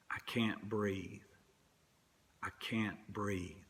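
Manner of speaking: slow, soft, strained repetition, each line sinking; quiet dying plea. Retold or imitated. Preacher echoing Eric Garner held down, slow and soft. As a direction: let the breath run out of each plea.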